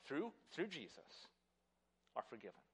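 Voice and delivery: gentle voice of Christ. Retold or imitated. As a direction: reassure softly. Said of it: "the words of Christ say".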